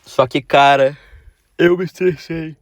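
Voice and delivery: ordinary talk, nothing marked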